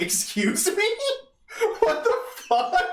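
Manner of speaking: high, loud disbelief through laughter; retelling a friend's duel challenge, laughing in shock; incredulous laughing. Retold or imitated. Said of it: "I was just like".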